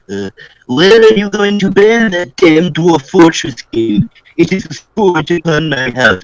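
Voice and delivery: high-pitched frantic villager voice; a high, fretful complaining commoner; high excited townsman. Acted.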